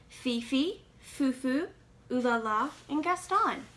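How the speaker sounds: usual speaking voice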